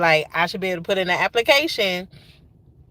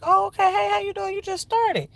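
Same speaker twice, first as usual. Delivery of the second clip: high-pitched chirpy retold hello; high, sing-song greeting to a new hire; retold friendly greeting; 10 semitones higher than usual. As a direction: imitate your own polite small talk, pitched way up. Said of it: "I'm like".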